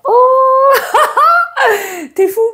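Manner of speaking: very high oh into laughter; squealing laugh. Real, not acted. Laughing outburst.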